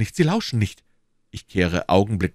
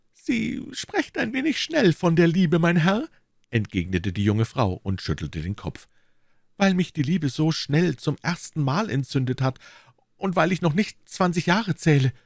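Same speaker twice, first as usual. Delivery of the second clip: put-on young woman; 10 semitones higher than usual. A narrator's reading. Young woman voiced in story.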